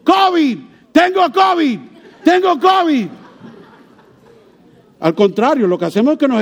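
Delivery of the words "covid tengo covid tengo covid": hammed-up announcing to everyone; mock shout. Preacher joking about announcing covid to the world, mock yell. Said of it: "Tener que gritarle al mundo".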